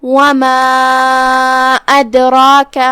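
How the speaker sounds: وَمَا أَدْرَاكَ chanted slowly, the ā of وَمَا held two to four counts; long madd. Shown as a demonstration. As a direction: hold the madd of وَمَا long, then a bouncing dal in أَدْرَاكَ. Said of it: "دو سے چار مد کے برابر". (مد منفصل کو لمبا کر کے)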